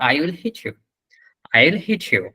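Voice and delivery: "hit" with a short falling i; no vowel stretch. Shown as a demonstration. Teacher shows the unstretched word in use, no vowel stretch in "hit". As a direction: keep "hit" short in the sentence. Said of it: "কোনো টান না দিয়ে বলতেছেন".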